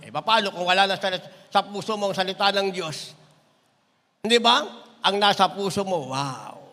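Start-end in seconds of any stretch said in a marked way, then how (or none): 6.1-6.6 put-on softly cooing girl, much quieter; whisper-soft like a girl finally being courted; mock smitten girl. Preacher voices a girl being courted. (mahinang boses ng kinikilig na dalaga)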